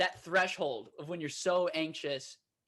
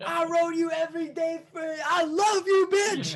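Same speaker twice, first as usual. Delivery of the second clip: mock shout; 11 semitones higher than usual. Yelling jokingly at the cement truck drivers, high hammed-up shout. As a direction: scream affection at the drivers.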